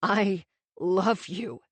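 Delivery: slow, halting, breaking on the words; barely getting it out, voice cracking; slow broken confession, nearly in tears. A narrator's reading. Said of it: "his voice cracked".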